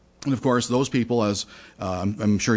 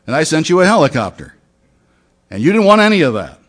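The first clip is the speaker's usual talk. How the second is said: rising rebuke; 5 semitones higher, 7 dB louder, faster than usual. Acted. God rebukes the drowned man.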